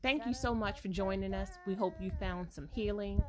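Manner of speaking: gently see the listeners off, quietly; quiet, measured closing message; podcast outro. Real, not acted. Recorded podcast sign-off.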